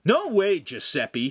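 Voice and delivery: high, loud refusal falling away; defiant cry. A narrator's reading. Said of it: "he cried".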